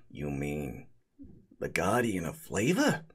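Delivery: low hushed reverence; awed golem voice. Acted. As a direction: breathe it out in awe.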